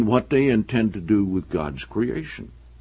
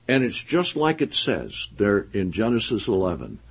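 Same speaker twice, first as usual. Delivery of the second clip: usual speaking voice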